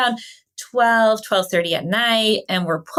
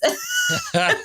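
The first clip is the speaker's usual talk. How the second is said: very high squealing laugh; real helpless laughter; crying with laughter; burst of laughter. Real, not acted. Realizes they walked into a house.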